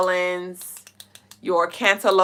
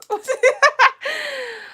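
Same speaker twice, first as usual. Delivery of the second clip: high burst of laughter; loud high laugh; 4 dB louder than usual. Real, not acted. Reacting to chat laughing.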